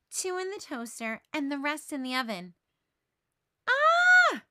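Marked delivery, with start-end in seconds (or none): 3.7-4.4 piercing high scream; loud horrified shriek; yell of dismay. A narrator's reading. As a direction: shriek at the ruined eggs. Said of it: "Peppermint Patty yells".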